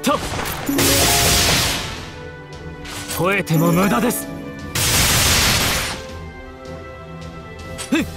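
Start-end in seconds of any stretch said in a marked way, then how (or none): 3.2-4.2 raised, rising combat call; battle taunt. Acted. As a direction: dismiss the foe while striking, pitched high. Attacks a foe. (高く張った戦闘の掛け声)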